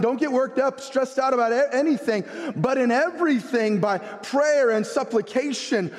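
high, urgent sermon delivery; impassioned preaching. Real, not acted. Pastor urging congregation to trust God over worry, impassioned preaching. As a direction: preach it with rising conviction.

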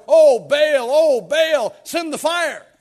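loud, high, desperate wailing; the prophets beg Baal at full volume; frantic cry. Acted. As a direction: shriek for fire from a silent god. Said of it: "dancing around this altar".

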